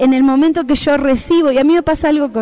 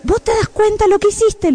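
scolding; 8 semitones higher than usual. Retold or imitated. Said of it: "le digo".

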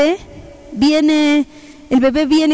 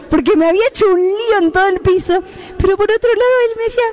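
usual speaking voice

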